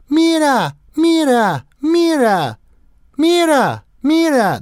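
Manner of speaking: put-on high, loud, whiny little-kid calls; imitating toddler. Mocking the neighbor's toddler, high loud whine. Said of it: "what I hear him say is".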